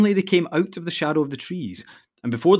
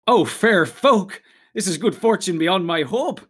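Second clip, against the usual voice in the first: high, louder, drawn-out exclamation; delighted awe; 5 semitones higher, 4 dB louder than usual. A narrator's reading. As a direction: gush with grateful delight, pitched up. Pippin delighted at the elves' invitation.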